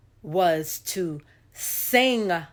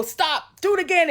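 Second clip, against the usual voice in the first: high, loud "stop, do it again"; a choir director cutting off and restarting; directing mother; 12 semitones higher, 6 dB louder than usual. Retold or imitated. Mother rehearsing her kids, high and commanding. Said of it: "she's doing all of this".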